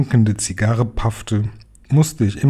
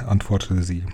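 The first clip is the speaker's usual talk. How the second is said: usual speaking voice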